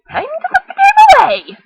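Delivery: put-on high, loud mother calling her kid; mom voice calling. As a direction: call it across the kitchen like mom. Mother calls Helen.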